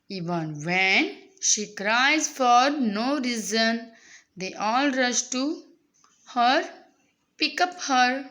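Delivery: very slow, phrase-by-phrase reading; teacher's slow read-aloud. Acted. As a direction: read it out slowly for young learners, pausing between phrases. Reads the monologue aloud to students. (सावकाश, थांबत थांबत वाचन)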